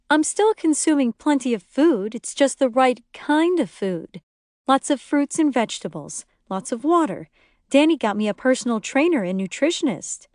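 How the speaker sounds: lightly voiced defensive mother; defensive dieter. A narrator's reading. Lori defends her diet.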